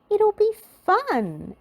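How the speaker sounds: put-on high, slow insistent girl voice; a naughty girl insisting, high and drawn out. A narrator's reading. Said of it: "insisted Little Miss Naughty".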